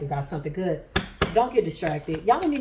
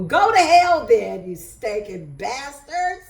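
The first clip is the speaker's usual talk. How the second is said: loud, slowing insult to haters; mock cursing out; 4 semitones higher, 6 dB louder, slower than usual. Real, not acted. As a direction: go to hell then, drawn out and loud.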